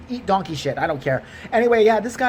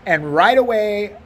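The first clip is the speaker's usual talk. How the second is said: usual speaking voice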